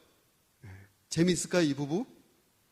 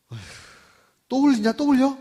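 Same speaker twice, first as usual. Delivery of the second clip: loud, higher-pitched nagging complaint; irritated scolding; 9 semitones higher, 8 dB louder than usual. Acted. Wife nagging her husband for spilling food, loud and exasperated. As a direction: scold him for spilling again.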